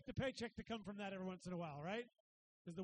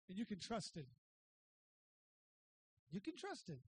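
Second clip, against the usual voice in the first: tender reassurance; 4 semitones lower than usual. Real, not acted. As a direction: lay it down gently. Sincere reassurance after build.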